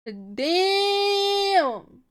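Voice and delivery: high, loud, long-held word; drawn-out exclamation. Real, not acted. Reader reacting after finishing the chapter, drawn-out exclamation. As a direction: stretch it out, loudly impressed.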